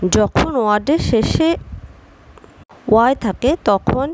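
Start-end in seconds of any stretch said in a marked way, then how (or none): none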